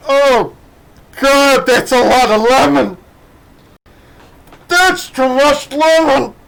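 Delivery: high, loud squeaky protesting stomach voice; a queasy little stomach pleading for mercy. Pretend. His overfull stomach complaining about the lemon, high and squeaky.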